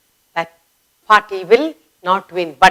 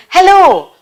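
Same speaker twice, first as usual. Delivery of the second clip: higher, louder call; calling hello; 9 semitones higher, 4 dB louder than usual. Shown as a demonstration. Teacher calling to someone far away. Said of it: "You will call up somebody".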